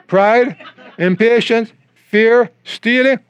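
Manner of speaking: normal talking voice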